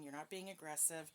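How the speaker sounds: ordinary talk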